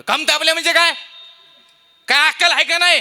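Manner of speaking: loud high scolding; angry rant. Retold or imitated. Preacher scolds father-in-law over the phone, loud. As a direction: scold him for his daughter.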